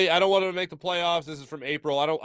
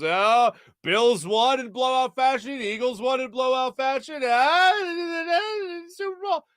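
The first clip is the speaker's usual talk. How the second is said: higher, squealing overexcited fan voice; mock hyped fan; 9 semitones higher, slower than usual. Host mocking fans' social media Super Bowl hype, high and giddy.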